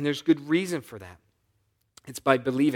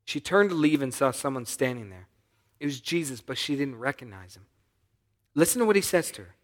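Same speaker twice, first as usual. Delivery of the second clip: ordinary talk, nothing marked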